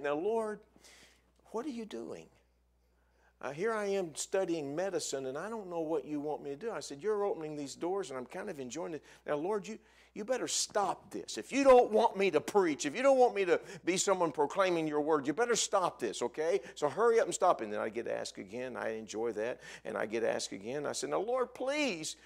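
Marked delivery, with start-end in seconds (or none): 0.0-17.6 high-pitched, worked-up plea to the Lord; acted-out ultimatum in a prayer; bargaining with God. 21.1-22.2 high, drawn-out mock plea; acted-out exasperated begging; pleading.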